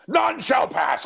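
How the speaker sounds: slow, high mock wizard decree; wizard gatekeeper. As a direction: block the way like a stage wizard. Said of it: "I said".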